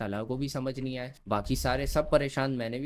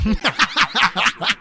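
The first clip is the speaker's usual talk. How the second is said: high, loud uncontrolled laugh; loud burst of laughter; 8 semitones higher, 11 dB louder than usual. Real, not acted. Storyteller cracking up after the cry baby jibe, laughing hard.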